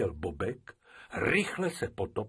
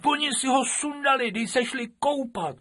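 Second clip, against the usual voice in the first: put-on observant girl; 5 dB louder than usual. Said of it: "upozorňovala všímavá Vaňousková".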